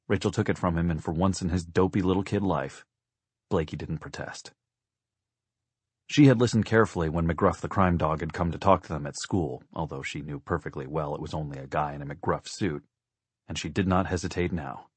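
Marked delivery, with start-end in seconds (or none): none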